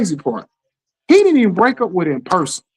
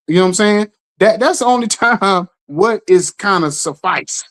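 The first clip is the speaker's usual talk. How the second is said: ordinary talk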